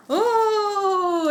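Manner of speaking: long, high, drawn-out "oh"; excited oh. Real, not acted. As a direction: let out a long thrilled coo. Delighted at a possible favourite theme. (long oh aigu et ravi)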